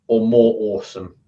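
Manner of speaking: a light touch of American on "awesome"; silly American accent. Shown as a demonstration. Coach slipping a light American accent onto "awesome". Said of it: "sound a stupid American accent".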